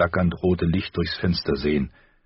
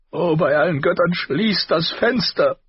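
desperate shout; 12 semitones higher, 4 dB louder than usual. A narrator's reading. Caitlin screams at Robb to close the window. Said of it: "Sie schrie".

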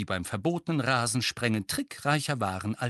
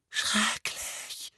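high, whispered croak; 11 semitones higher than usual. A narrator's reading. Dudley remembers the cold, croaking. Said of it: "krächzte Dudley".

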